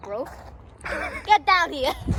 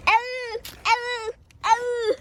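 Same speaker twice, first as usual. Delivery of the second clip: pained ow cries; 12 semitones higher, much slower than usual. Acted. Getting hurt.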